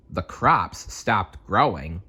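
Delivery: plain reading voice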